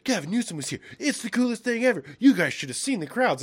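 high, gushing imitation of fans; hammed-up fan hype; mock excitement. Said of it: "Everyone's like".

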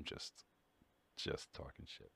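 breathy throwaway whisper under the breath; whispered aside. Real, not acted. Streamer admitting to chat he has no real knowledge, whispered. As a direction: whisper it like a confession. Self-deprecating aside after disclaimer.

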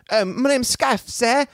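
a grateful urchin tipping his cap; meek orphan boy. Acted. Orphan boy thanking his hero, high and timid.

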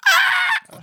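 high shrill fake scream of terror; short hammed-up horror-movie scream; mock scream. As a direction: shriek like the diver being grabbed. Diver screams in retold scene.

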